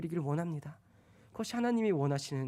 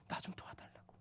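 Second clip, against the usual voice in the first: soft whispered plea; 19 dB quieter than usual. Acted. Kneeling believer begging God, whispered. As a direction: breathe the prayer out.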